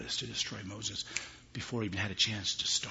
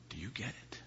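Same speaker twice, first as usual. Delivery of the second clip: whispered, hushed repeat; preacher whispering to the congregation; 8 dB quieter than usual. Real, not acted. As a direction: drop to a whisper.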